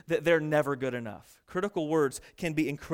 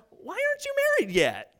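much higher put-on prying voice; nosy busybody. Preacher mimics a meddling acquaintance, high and prying. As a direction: play a nosy aunt prying.

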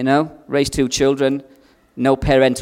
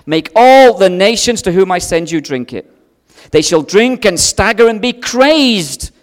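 thundering proclamation; 5 semitones higher, 7 dB louder than usual. Acted. Preacher declaiming the Lord's wrath, loud and high. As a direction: boom out God's judgment on the nations.

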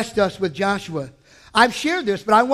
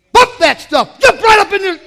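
much louder, higher battle cry; rallying shout; 9 semitones higher, 8 dB louder than usual. Real, not acted. Preacher urging the congregation to jump in, shouting. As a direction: shout them to their feet.

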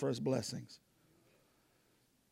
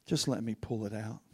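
softer, lower, tender plea; gentle voice of God; 8 semitones lower, 11 dB quieter than usual. Acted. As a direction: coax softly like a caring God. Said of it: "And God's saying".

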